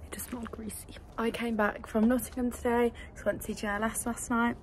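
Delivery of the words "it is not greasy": a hushed, breathy aside; whisper. Real, not acted. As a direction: whisper it like a little secret. Confiding aside to camera.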